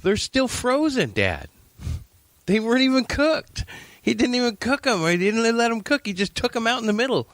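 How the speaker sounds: high, fast mock whine of outrage; imitating pissed-off teen. Teen son complaining to dad about uncooked fries, high indignant rant. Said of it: "So he's all pissed off".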